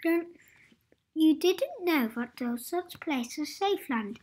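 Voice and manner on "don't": plain reading voice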